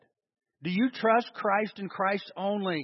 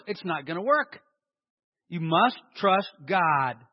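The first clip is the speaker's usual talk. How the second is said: ordinary talk, nothing marked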